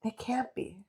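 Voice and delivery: very soft, stunned retelling; hushed disbelief. Retold or imitated. Voicing heroine who thought she killed him, hushed disbelief. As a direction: barely voice it, as if stunned.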